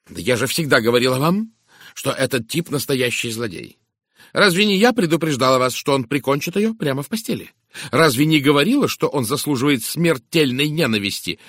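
indignant. A narrator's reading. Woman insisting she warned everyone about the husband, indignant. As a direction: hammer home each reproach heatedly. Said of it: "возмущённо воскликнула мисс Говард".

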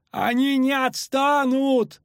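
high, slow wail; despairing moan. A narrator's reading. Ferryman fears pursuit, despairing high moan. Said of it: "застонал паромщик".

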